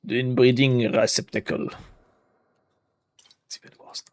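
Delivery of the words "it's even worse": breathy whispered aside, no voice. Real, not acted. Player whispering a dark joke to viewers, whispered. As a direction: whisper it like a guilty secret.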